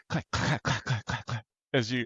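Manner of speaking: soft, rhythmic ice clacks; imitated clacking. Shown as a demonstration. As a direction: tick out the sled's rattle in quiet beats. Imitates sled touching the ice.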